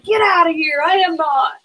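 high, laughing brush-off; husband laughing off the Irish proof, high; laughing denial. Retold or imitated. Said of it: "And he's like".

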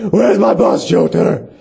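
hammed-up shouted superhero demand; deafening mock interrogation; Batman. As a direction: shout it as a masked vigilante. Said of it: "we're done deafening the listeners".

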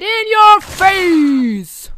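loud, slow drawn-out taunt; taunting yell. Acted. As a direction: gloat loudly at them.

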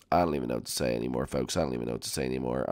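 low, soft, hurried grumble; defeated muttering. Real, not acted. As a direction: run the words together under your breath. Dejected about the water world.

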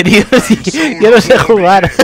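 high, loud laugh through the words. Real, not acted. Gamer laughs at his own lack of skill, laughing. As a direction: crack up at yourself.

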